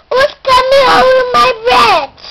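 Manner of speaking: high, loud troll bellow; troll shout. Acted. Child as troll shouting at the middle goat, high and loud.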